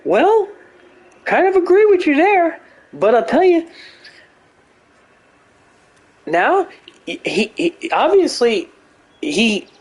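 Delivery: high, stammering mock Jordan Peterson; Jordan Peterson impression. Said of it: "He'd be like".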